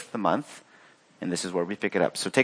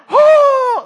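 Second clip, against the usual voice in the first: very high, loud, drawn-out "oh"; mom's astonished shriek, mimicked; imitated shocked cry; 11 dB louder than usual. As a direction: let out a piercing whoop of shock. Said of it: "she's just shocked".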